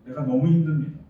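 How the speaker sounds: loud, exasperated protest; arguing complaint. Acted. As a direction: demand an answer from God. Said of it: "라고 따지는 거죠".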